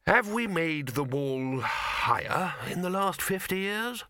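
high, slow, halting question from a winded lecturer. A narrator's reading. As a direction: ask it puffed out after a climb. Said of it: "leaning on the wall to stop the shaking".

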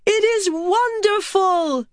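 slow, very high exultant cry; drawn-out, high-pitched delight; elated. A narrator's reading. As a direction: revel in it, overjoyed. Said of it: "She laughed".

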